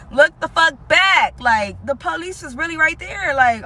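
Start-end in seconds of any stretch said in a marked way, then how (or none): 0.1-1.4 loud, slow, higher yell; retold yell. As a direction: bark it slow and loud at the friend. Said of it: "And I'm like".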